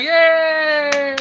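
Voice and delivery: high loud yay; squealed yay; retold cheer. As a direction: cheer like you just scored. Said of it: "I got four, like".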